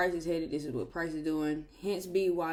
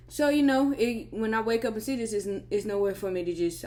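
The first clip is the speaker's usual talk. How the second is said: louder over the air conditioner; raised voice to be heard; 4 semitones higher, 5 dB louder than usual. Real, not acted. Suddenly louder, to carry over AC.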